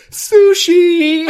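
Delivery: very high, loud squeal; excited squeal. Retold or imitated. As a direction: shriek it with glee. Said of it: "they both go".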